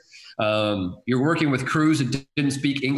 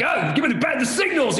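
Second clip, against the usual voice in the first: much higher, rapid director calls; excited shot calling; 12 semitones higher than usual. Retold or imitated. Director cutting a blowout game, calling shots with glee. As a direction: fire off camera calls, buzzing with fun.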